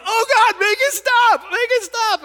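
high, loud hammed-up wail; mock anguished millennials. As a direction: wail like the noise is torture. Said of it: "millennials are going like".

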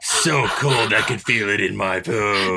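slow, hard-boiled pulp narration; noir detective. Retold or imitated. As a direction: drag it out like a brooding noir narrator. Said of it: "go full Frank Miller and just put, like, a block of text".

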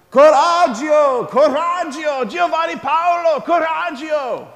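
high, loud, drawn-out Italian yell; imitated screaming. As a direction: call up to a high window from the square. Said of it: "was in Saint Peter's Square screaming".

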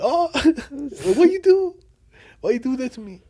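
very high, laughing complaint; mock protest. Retelling his reaction to the highway insult, mock protest. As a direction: play up wounded disbelief, loud and high.

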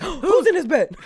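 high, loud gasping alarm, laughing; gasp, then shout it in fake shock; mock startled. Husband waking to a manly voice beside him, mock alarmed. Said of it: "don't wake up like".

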